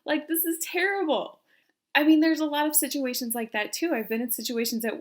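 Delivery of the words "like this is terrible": high, fed-up rant at herself; mock frustration, pitched up; higher, mildly exasperated retelling of her own outburst; exasperated self-scolding. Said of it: "even more mad then because I'm like".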